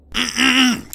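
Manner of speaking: loud double throat clear; rough, audible ahem. Real, not acted. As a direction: clear your throat hard. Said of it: "Clear my throat here".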